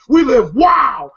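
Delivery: slow, loud high shout. Retold or imitated. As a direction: yell it slowly. Day Day shouting.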